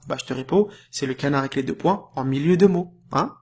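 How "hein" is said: ordinary talk, nothing marked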